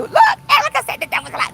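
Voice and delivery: sudden high fast excited call to a follower; excited shout. Real, not acted. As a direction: burst out high and fast at Erica's comment.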